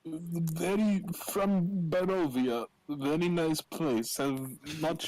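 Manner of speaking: put-on slow Transylvanian accent sliding into Borat; broken-English Transylvanian accent. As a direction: attempt a Transylvanian accent that drifts toward Borat. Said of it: "My Transylvania accent keeps turning into, like, a Borat accent".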